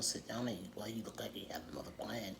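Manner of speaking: hushed confiding whisper. Real, not acted. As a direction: lean in and whisper the suspicion. Confiding aside about his plan.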